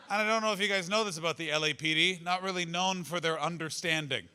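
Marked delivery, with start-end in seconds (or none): none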